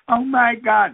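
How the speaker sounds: high, loud, hammed-up despair; mock distraught mother. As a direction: play the mother falling apart. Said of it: "the woman is crying".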